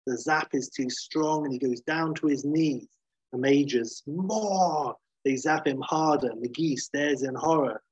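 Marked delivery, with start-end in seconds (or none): 4.1-5.0 high, drawn-out order to intensify; mock commanding cry, pitched up; commanding call. Major orders them to zap Hulk harder, commanding call.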